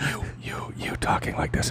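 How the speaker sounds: barely voiced, whispery and very soft; mock faint mic. Host mimicking his co-host's failing mic, whispered. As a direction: imitate a mic that hardly picks you up. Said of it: "it'd be like".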